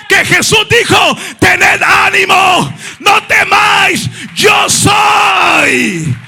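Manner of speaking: full-force fervent preaching. Acted. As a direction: cry it out with fire.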